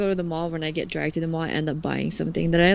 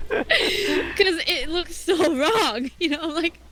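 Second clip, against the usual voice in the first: laughing; 7 semitones higher than usual. Real, not acted. Laughing about melting ice cream.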